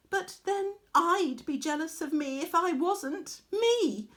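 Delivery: preen over every word like a spoiled diva; arrogant boast. Acted. Arachne boasting to her mother, high and vain.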